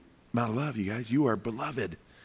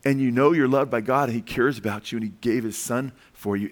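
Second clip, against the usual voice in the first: normal talking voice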